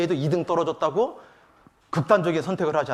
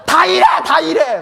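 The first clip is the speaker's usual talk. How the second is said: slow, high, very loud mock protest; teenage yelling; 13 semitones higher, 12 dB louder, slower than usual. Middle-schooler answers criticism of her uniform, yelling imitation. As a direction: holler it back like a defiant teenager, hammed-up. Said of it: "갑자기 막 소리를 질러요".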